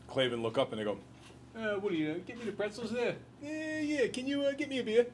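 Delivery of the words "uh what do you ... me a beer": high-pitched comic bar regular; goofy high barfly voice; barfly impression. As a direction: butt in with a put-on, high voice. Voices bored barflies interrupting reading.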